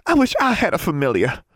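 put-on high, girlish wishful gushing; excited, pitched well up; enthusing. A narrator's reading. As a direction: gush with eager longing. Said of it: "she enthused".

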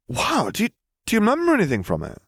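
high, fast stammering wonder; breathless eager boy; awed boy. A narrator's reading. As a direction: blurt it out in awe. Ron awed.

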